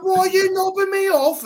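very high, loud mock British voice; British accent. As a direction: squawk it slowly in a hammed-up British accent. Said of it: "British people's accents make everything ten times funnier".

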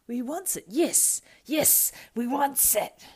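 greedy Gollum. Acted. Gollum giving in to the ring, greedy. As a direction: grab at it with greed.

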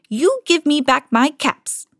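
high, drawn-out scolding demand. A narrator's reading. Peddler shaking a finger at monkeys, scolding them. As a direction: scold slowly, pitched well up. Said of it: "shaking a finger at them".

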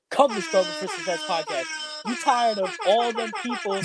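high-pitched put-on promo pitch; Suge Knight. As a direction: call out like a record boss at an awards show. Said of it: "let me get my Suge, Suge Knight, uh, voice on".

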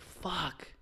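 held-back mock yell of frustration; retold scream. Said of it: "I scream in a loud voice".

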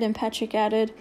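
ordinary delivery, nothing marked

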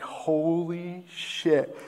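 slow, drawn-out amazed curse; stunned disbelief. Retold or imitated. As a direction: let it sink in slowly, in awe. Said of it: "I'm like".

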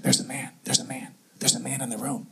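very soft, low, repeated murmur; imitated frightened whisper. Wife warns husband at night, imitated frightened whisper. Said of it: "I'm kinda whispering. And she's, and she says".

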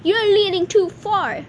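high, loud storybook warning; shouted warning. A narrator's reading. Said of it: "shouted Mister Gloop".

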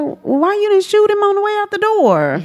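a high, baffled "why didn't you shoot"; incredulous high question. Retold or imitated. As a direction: ask it in comic disbelief, way up high. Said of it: "And I was like".

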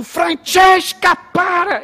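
high, loud, stretched-out yell; slow, hollered command; retold shout. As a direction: bellow an order from inside the bar. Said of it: "gritou lá de dentro".

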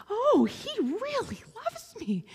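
high, slow voice full of wonder; awed little girl. Retold or imitated. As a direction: say it like a child just realizing it. Girls touched in prayer.

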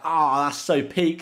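high, loud and slow "Oh, that's so peak"; retold dismay. Retelling a harsh red card, drawn-out dismay. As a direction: drag out "peak" in mock despair. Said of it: "And I'm like".

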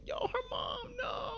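high, cracking shocked wail; anguished cry. Retold or imitated. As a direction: cry out as it sinks in. Reacting to the mother in the group.

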